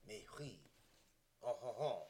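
very soft mock French murmur; hushed French-accented chuckle; bad French accent. Shown as a demonstration. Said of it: "with a bad French accent".

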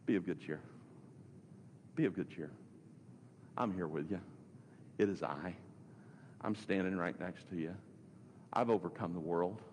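low, soft, tender assurance; calm reassurance. Retold or imitated. Preacher gives Jesus' words to the discouraged, softly reassuring.

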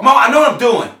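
high, loud exasperated retort; almost yelling it at mom; snapping at mom. Retold or imitated. Son yelling at his mom during the car fight, loud and high.

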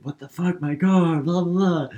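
high, loud mock outrage; outraged car owner. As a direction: imitate a guy yelling about his car. Acted outraged car owner in retelling.

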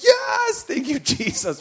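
high, loud cheer with a laugh; joyful shout. Retold or imitated. Father hearing his son finally hit, high joyful yell. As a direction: cheer like your prayer was answered. Dad celebrating son's hit.

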